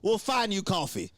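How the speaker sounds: high, forceful imitation of staff; mock-irate backstage command; barked order. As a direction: order the talent indoors, urgently. Staff ordering him inside, retold.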